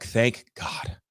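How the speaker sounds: breathy relieved thank God; relieved whisper. Retold or imitated. A relieved realtor whispering thanks to the meeting partner. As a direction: sigh it out in relief. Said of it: "I was like".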